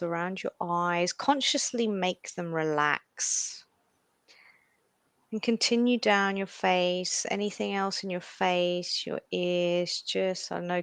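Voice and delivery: slow, soft meditation voice; guided meditation. Acted. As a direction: guide them into calm, slowly. Leads a meditation.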